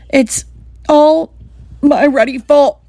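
high, halting mock sob; acted sobbing. A narrator's reading. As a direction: blurt it out in broken, tearful pieces. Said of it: "he sobbed".

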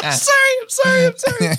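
high, squeaky laughing apology; words squeezed out by real laughter; crying with laughter. Real, not acted. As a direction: apologise through uncontrollable giggles. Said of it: "I'm crying".

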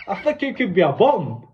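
high quote broken by laughter; laughing while quoting. Retold or imitated. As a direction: try to deliver the line but crack up. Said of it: "this is true story".

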